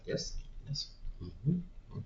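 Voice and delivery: quiet, meek yes yes; meek whispered agreeing. Retold or imitated. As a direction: murmur agreement softly. Meek compliant nodding along.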